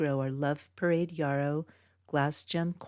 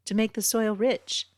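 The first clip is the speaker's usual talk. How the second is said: higher, louder triumphant punchline; 7 semitones higher, 6 dB louder than usual. Acted. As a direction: deliver the punchline big and bright. Punchline of farmer joke.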